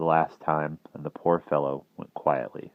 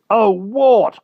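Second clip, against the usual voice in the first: high loud eager call; cried out; 13 dB louder than usual. A narrator's reading. Kay greeting Wart, loud and high. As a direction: burst out to your old friend. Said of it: "cried Kay".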